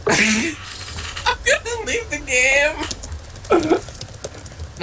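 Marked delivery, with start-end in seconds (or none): 0.1-2.8 high frustrated whine. Real, not acted. Frustrated gamer outburst.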